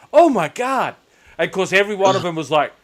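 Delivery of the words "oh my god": high, loud mock astonishment; imitated American awe, pitched up; amazed Americans. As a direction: gush like a starstruck American. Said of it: "'cause they're like".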